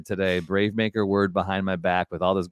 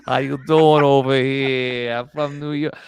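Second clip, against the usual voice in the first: bad New York accent; 5 semitones higher, 5 dB louder than usual. Host greeting guests in a mock New York accent. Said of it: "that New York accent was terrible".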